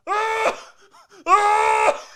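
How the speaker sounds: high, loud mock screams; mock agonized high shrieks; imitated yelps of pain. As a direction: scream out like the tormented guy, hammed up. Imitating the guy's pained cries.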